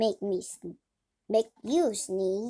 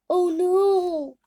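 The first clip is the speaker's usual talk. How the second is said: alarmed; 8 semitones higher, 8 dB louder than usual. Acted. Gerald realizes the problem.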